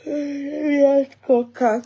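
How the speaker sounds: words stretched by a yawn. Real, not acted. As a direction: talk through a big yawn. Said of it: "sorry, I'm tired".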